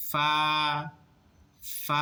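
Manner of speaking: ফা with air let out on the f before the vowel; airy fa. Shown as a demonstration. Teacher showing the letter ফা, air released first. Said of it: "লাগিয়ে এরপর sound করতে".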